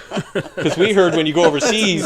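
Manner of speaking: chuckling through the line; laughing. Real, not acted. Explaining the overseas rumor to friends, laughing.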